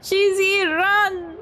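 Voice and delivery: high, urgent warning cry; urgent alarm. Acted. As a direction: call out a sudden warning to flee. Warning others to flee.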